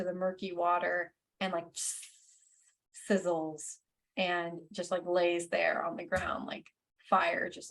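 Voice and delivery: hushed whisper narration. Acted. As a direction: whisper it as the fire dies. GM describes dying fire.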